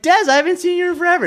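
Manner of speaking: high-pitched, rushed, overexcited greeting; gushing, sky-high imitation of an eager hello; excited mock greeting. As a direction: squeal it out fast, hamming up the excitement. Acting out her own eager greeting.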